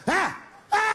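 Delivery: high yelping climax cry; mock orgasm cry. Squealed climax.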